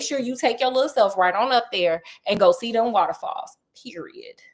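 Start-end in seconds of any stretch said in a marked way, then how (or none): 3.8-4.4 unvoiced breathy period; whispered period. Telling viewers to go, a whispered period. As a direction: whisper the word period for emphasis. Emphatic sign-off word.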